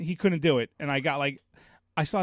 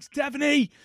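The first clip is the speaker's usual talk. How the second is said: higher, desperate call for help; 9 semitones higher, 4 dB louder than usual. Retold or imitated. Dad calling his wife for rescue, exasperated call. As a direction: call her name across the house, fed up.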